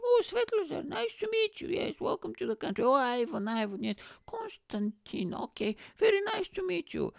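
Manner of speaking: very high falsetto royal greeting; mock-regal and sky-high; Queen impression. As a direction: play the Queen welcoming guests in a high, fluting voice. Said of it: "The Queen is like".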